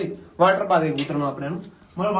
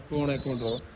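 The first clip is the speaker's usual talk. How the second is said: ordinary talk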